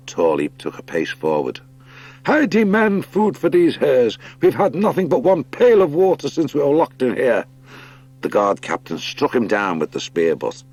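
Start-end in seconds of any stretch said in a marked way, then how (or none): 2.2-7.4 defiant high hare. Acted. Tall Leap demanding food from the guard captain, high and loud. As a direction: stand up to the guards, insist on food.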